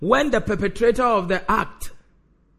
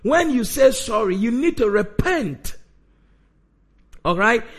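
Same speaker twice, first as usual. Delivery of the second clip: usual speaking voice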